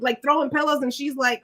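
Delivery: ordinary talk, nothing marked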